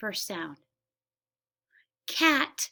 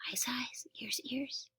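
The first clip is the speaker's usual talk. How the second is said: whispering; 15 dB quieter, slower than usual. Acted. Whispered sound-game chant.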